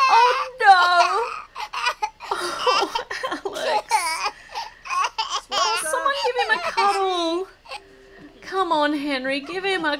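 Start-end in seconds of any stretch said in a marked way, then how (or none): 0.1-7.5 laughing. Real, not acted. Toddler asleep in high chair.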